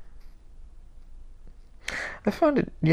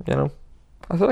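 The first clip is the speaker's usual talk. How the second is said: normal talking voice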